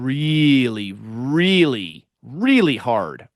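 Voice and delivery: each "really" stretched long with a swooping stress; emphatic repetition. Real, not acted. Admitting harshness toward a younger self, "really" heavily drawn out. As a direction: draw out every "really" slowly, leaning on it.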